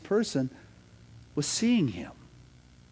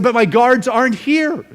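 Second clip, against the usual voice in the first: high, loud, helpless protest; flustered protest; 8 semitones higher, 13 dB louder than usual. Acted. Prince told to call his absent guards, high flustered protest. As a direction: protest loudly, pitch jumping up. Prince's threat collapses, voice jumps up.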